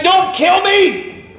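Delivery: very high, slow desperate cry; David. Acted. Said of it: "This is David going".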